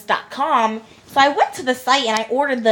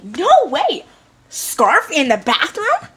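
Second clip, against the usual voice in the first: shocked; 6 semitones higher than usual. Retold or imitated. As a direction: gasp out the disbelief, going higher. Shocked reaction to gossip.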